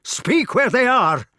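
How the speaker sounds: high, loud angry roar; furious shout. Acted. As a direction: bellow at him to answer. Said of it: "he shouted".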